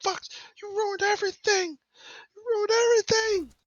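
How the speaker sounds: very high, strained furious rant; screaming. Acted. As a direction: rage at your captors in a squeezed scream. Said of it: "he's just screaming".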